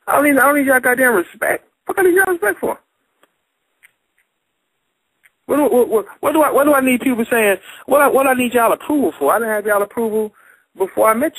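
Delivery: high, indignant questioning; high, heated rhetorical questions; heated rant. Real, not acted. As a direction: keep pushing back, incredulous. Heated argument about respect.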